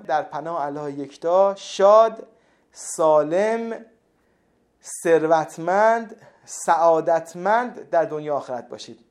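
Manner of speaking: each wish set apart, slow and firm; slow farewell blessing. Acted. Host blessing his viewers at the end, slow and emphatic. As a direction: bless the viewers like a warm sign-off.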